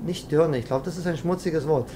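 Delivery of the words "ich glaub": ordinary talk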